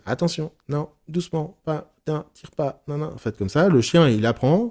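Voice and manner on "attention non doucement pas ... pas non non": higher, softer running commands; nagging dog owner. Acted. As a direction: imitate an owner fussing at a dog on the leash. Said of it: "Si vous dites à votre chien".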